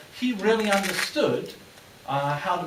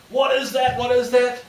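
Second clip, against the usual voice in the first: suspicious guard call; 7 semitones higher, 6 dB louder than usual. Retold or imitated.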